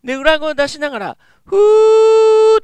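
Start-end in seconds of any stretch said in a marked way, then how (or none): 1.4-2.6 high, very slow falsetto; falsetto hoo. Shown as a demonstration. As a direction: hold a head-voice hoo. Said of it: "ふーって口の中の". (裏声で「ふー」)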